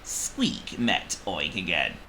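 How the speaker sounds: ordinary delivery, nothing marked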